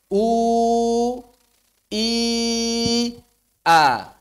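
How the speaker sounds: "U, i, a" slow, each vowel sounded apart; over enunciating. Shown as a demonstration. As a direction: sound out "U, i, a" clearly. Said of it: "Bareng-bareng".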